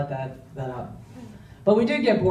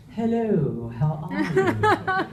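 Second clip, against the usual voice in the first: put-on plummy upper-class English accent, pitched far higher and slowed, laughing at the end; posh Queen's English; 7 semitones higher, slower than usual. Father's newly softened posh greeting, a laughing Queen's English imitation. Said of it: "to the posh Queen's English".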